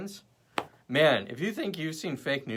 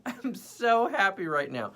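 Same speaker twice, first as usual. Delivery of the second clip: high, slow, giggly happiness; mock blissed out; 7 semitones higher, slower than usual. Imitating herself happily high, slow and giggly. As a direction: savour each word, blissed. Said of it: "I'm like".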